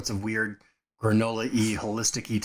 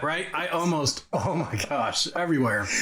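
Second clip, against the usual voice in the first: ordinary talk, nothing marked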